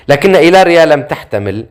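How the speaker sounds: ordinary delivery, nothing marked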